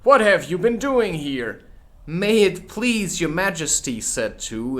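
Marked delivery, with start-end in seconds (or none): 0.0-1.6 higher, louder interrogation; demanding Queen. Acted. The Queen demands what the gardeners are doing. 2.1-4.0 higher, louder begging gardener; bow and beg; humble gardener. Acted. Gardener Two begs the Queen humbly. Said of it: "in a very humble tone".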